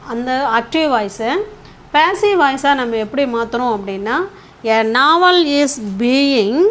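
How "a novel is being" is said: very slow, stretched phrase; slow dictation. Shown as a demonstration. Teacher showing active turned to passive, slow dictation. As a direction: draw out each word of the model sentence.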